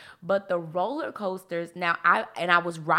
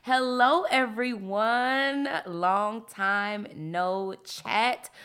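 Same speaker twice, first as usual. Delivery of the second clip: excited host greeting; 2 semitones higher, slower than usual. Real, not acted. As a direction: greet listeners with big, drawn-out excitement. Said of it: "I am so excited to share".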